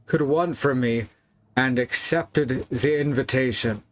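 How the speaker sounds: put-on Wilhelm's letter in a clumsy foreign accent; bad put-on accent. Player reads Wilhelm's final confession aloud, bad put-on accent. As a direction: read it formally with a badly faked accent. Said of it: "I apologize for my bad accent".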